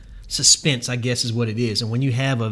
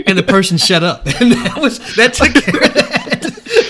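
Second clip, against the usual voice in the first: words broken by high laughter; laughing while talking; 11 semitones higher, 10 dB louder than usual. Real, not acted. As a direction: laugh through the punchline. Laughing through punchline story.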